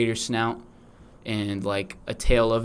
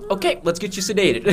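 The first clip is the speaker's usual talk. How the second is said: mock doctor, higher and laughing; 8 semitones higher than usual. A friend voicing a doctor, laughing. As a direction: play the cheery doctor. Doctor voiced in joke skit.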